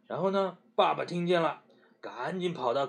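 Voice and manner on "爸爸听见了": plain reading voice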